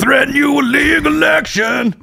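high shouted rock star voice. Pretend. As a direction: bellow like an angry rocker. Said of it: "my spot-on Nickelback impression".

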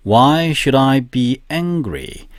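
each word drawn out very slowly; very slow model sentence. Shown as a demonstration. Teacher to students, reading the sentence very slowly. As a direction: read it slowly for students to copy. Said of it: "그 다음 문장".

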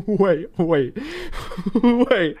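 high-pitched waits broken by laughter; laughing disbelief. Real, not acted. Streamer watching the game unfold, laughing disbelief. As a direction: crack up while repeating it, pitch climbing.

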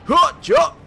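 high shouted force jump; high yelled jump command; battle yell. Real, not acted. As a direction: yell it as you leap. Yelling while using force powers.

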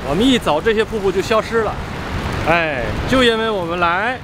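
ordinary talk

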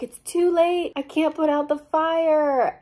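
high, loud mock wail; hammed-up melodramatic cry; Marcy. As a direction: call it out high, in mock despair. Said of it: "And then Marcy's like".

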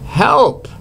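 sudden very high cry for help; screaming. A narrator's reading. Goldilocks waking to the bears, high scream. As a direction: yelp it out in fright. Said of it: "She screamed".

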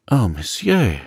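put-on earnest young man's exclamation; heartfelt cry. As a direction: cry out gratefully, falling away. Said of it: "cried Dante".

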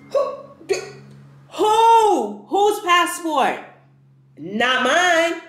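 high-pitched, incredulous re-enacted outrage; indignant disbelief. Traveler balking at staff taking her passport, indignant disbelief. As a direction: balk in a high, stretched-out voice, hammed up.

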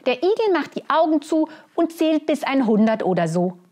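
high, lively squirrel voice. Acted. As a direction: explain it like an eager squirrel. Squirrel explains the game.

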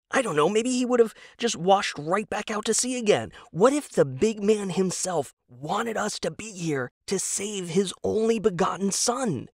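voiced husband, keen and hopeful; eager husband Tom. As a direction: pitch it up like a naive true believer. Tom's eager pitch.

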